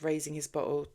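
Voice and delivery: ordinary delivery, nothing marked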